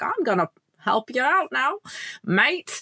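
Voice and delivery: bad Australian accent, lifted and quick; a hammed-up Aussie ranger offering help. Podcast host mimics the Australian ranger in a bad Australian accent. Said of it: "I'm just imagining his accent".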